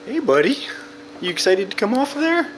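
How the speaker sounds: high voice for a little buddy; high playful voice. Pretend. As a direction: chirp at the toy figure. Talking to toy figure.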